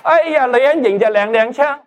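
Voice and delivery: high-pitched, lightly hammed-up sissy exclamation; lightly mocked effeminate man. Preacher joking about the new word, lightly mock-effeminate. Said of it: "好像娘娘这样".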